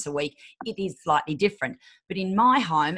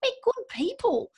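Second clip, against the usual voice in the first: sweet high voice; 4 dB quieter than usual. Acted.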